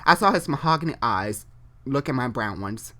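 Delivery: ordinary delivery, nothing marked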